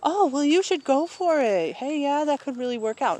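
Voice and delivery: high, loud mock cheer of a well-meaning friend; mock helpful friend. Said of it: "trying to be nice, trying to be helpful".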